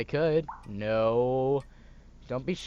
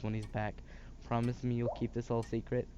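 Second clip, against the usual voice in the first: usual speaking voice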